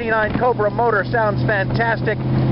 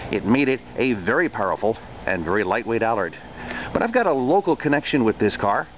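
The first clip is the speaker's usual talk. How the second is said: documentary voiceover; 7 semitones lower than usual. A narrator's reading. Voiceover narration of car history.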